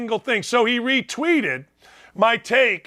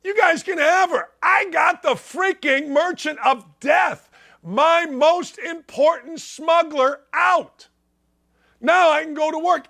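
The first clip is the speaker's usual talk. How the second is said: higher, slow, half-shouted gloat; gloating Putin; 5 semitones higher than usual. Retold or imitated. Host voices Putin gloating over the swap, slow half-shout. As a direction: crow over the prisoner swap like you won. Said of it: "Putin's reaction is simple".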